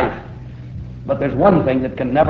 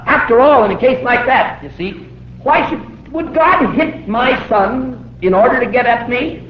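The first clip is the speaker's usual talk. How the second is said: doubting objector; 7 semitones higher than usual. Acted. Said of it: "but someone says".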